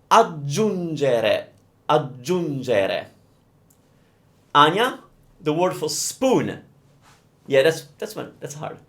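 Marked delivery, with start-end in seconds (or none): 0.1-3.0 aggiungere drawn out slowly, every syllable clear; slow demo. Shown as a demonstration. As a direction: stretch out aggiungere for learners. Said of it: "Do you mean to add".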